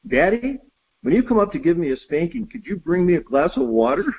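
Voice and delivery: put-on high innocent kid's voice, a laugh breaking in; sweet cheeky child asking; little boy voice. The boy asks his angry dad for water, innocent high voice with a laugh.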